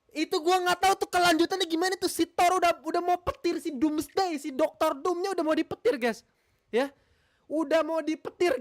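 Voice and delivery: high, fast and loud; excited retelling. Real, not acted. Streamer telling viewers how the Thor scene cut off, excited and fast. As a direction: rush through the story, worked up.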